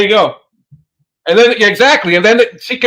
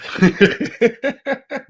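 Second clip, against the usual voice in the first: amused laugh; 3 semitones higher, 6 dB quieter than usual. Real, not acted. Laugh after reading text.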